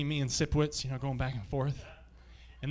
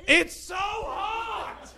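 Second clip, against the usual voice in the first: very high, slow squealing animal voice, ending in a shout; a heat-crazed animal begging to get out; zoo animals complaining; much slower than usual. Pretend. Sweltering zoo animal whining to the crowd in a high squeal. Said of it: "they'd just be going".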